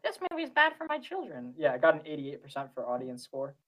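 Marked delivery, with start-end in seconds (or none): 0.0-1.5 high, whiny mock parent voice; mock an outraged parent. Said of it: "stupid parents that are like".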